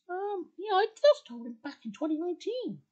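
high, small character voice; pitched well up, a touch forlorn; sad Spinel. Acted. Spinel answers Ben's question, high-voiced and subdued.

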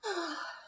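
high, drawn-out pitying sigh; theatrical sigh. A narrator's reading. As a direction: sigh with fake sorrow. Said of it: "and she sighed".

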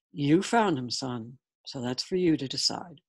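measured paternal reply; father's voice. A narrator's reading. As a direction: speak low as a father to his son. Father hands son the decision.

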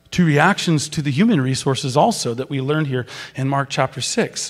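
ordinary delivery

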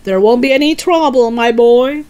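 loud, high, overriding father; boisterous confidence; excited cry. A narrator's reading. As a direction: wave away the worry loudly. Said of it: "cried Mister Cranky".

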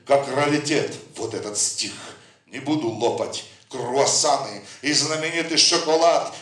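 ordinary talk

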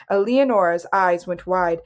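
usual speaking voice

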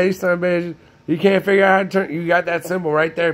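ordinary talk

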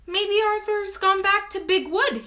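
plain reading voice

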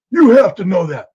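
loud, high demand; yelling interrogator. Retold or imitated. Agent demanding Marina admit it, loud high shout. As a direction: bark it at her.

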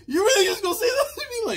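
mock her wounded whine, high and quick; teasing imitation of a hurt girl; imitating Rebecca. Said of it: "she's just like".

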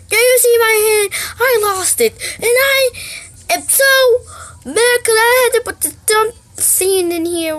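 high, loud crying rant; angry rant. Acted. Mom ranting at the kid about her show, tearful fury. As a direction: wail at the kid, near tears.

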